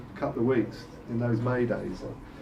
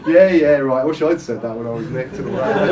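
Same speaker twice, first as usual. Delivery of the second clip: sarcastic scoff; 8 dB louder than usual. Retold or imitated. Mocking a costumed Brunel's excuse, loud sarcastic scoff. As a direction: scoff at his excuse, loudly. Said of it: "I was going like".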